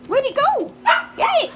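high, eager urging; excited high voice urging the puppy to get it. Real, not acted. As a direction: cheer the dog on to grab it. Excited urging dog to grab chew.

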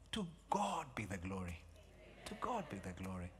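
soft praise. Real, not acted. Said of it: "repeated praise".

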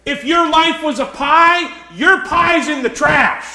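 forceful, building sermon delivery; full-force preaching. Real, not acted. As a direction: drive each point home to the congregation.

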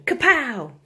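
high, loud burst; comic-book kapow. A narrator's reading. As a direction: punch the word out loud. Comic-book punch exclamation.

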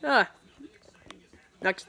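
usual speaking voice

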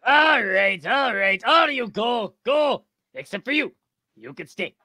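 high cartoon captain, accidentally Russian-sounding; Mr. Krabs. Friend redoing the meme for laughs, Russian-tinged Mr. Krabs. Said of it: "I don't know why you sound so Russian".

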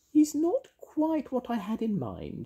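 high fairy voice, not truly hushed; piping stage-whisper of a fairy. A narrator's reading. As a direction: confide it in a tiny high voice. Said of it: "she whispered".

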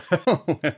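quick self-mocking chuckle; short laugh. Real, not acted. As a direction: laugh at yourself. Laughing at herself.

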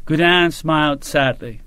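ordinary delivery

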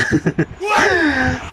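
a high, bright burst of real laughter. Real, not acted. Tawa pagkatapos ng kuwento. (mataas na tawa)

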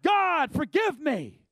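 high shouted plea; crying out. Acted. Worshipper on their face crying out to God for forgiveness. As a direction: cry out for forgiveness. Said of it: "crying out".

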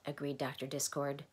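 plain reading voice

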